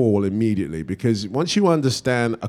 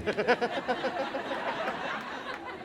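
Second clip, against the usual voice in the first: high, soft trailing laugh; 11 dB quieter than usual. Real, not acted. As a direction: laugh lightly at your own impression. Laughs after his own imitation.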